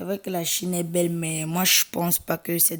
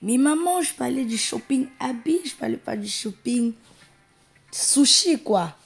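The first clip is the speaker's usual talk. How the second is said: exasperated daughter; 6 semitones higher, 4 dB louder than usual. Acted. Fille corrige sa mère.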